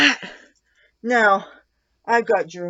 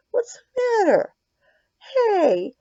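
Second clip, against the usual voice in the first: high cooing pet talk, louder; 12 semitones higher, 4 dB louder, slower than usual. Real, not acted. Owner cooing at her cat, high baby talk.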